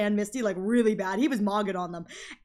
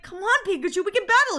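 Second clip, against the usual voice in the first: higher and louder, urging; pleading Ash; 8 semitones higher, 4 dB louder than usual. Retold or imitated. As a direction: coax your Pokemon to fight. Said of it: "And, and Ash is like".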